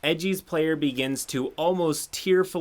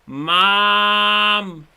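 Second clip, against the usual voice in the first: high, loud desperate yell; yelling for mom; 9 semitones higher, 11 dB louder than usual. Retold or imitated. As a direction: shout up the stairs for mom. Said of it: "and yells".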